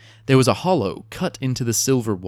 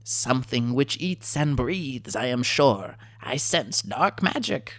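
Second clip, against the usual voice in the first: ominous kobold; 3 semitones higher, slower than usual. A narrator's reading. Kobold sorcerer warning of dark magic, slow and eerie. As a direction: hush it like dark lore.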